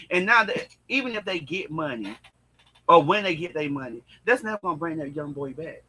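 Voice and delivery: ordinary delivery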